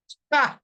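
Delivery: high loud cackle of real laughter; laughing burst. Real, not acted. Streamer cracks up at a chat name, loud high laugh.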